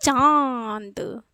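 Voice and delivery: चाँद with long nasal आँ through mouth and nose; slow nasalized demo. Shown as a demonstration. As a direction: stretch चाँद, letting the vowel ring in the nose. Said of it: "मुख और नाक दोनों पे जोर".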